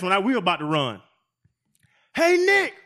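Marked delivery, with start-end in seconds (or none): 2.1-2.7 high, loud yell from far off; hollering down the field to the coach; calling across the field. Retold or imitated. Lineman walking the forty yells to Nick Saban, a loud high call.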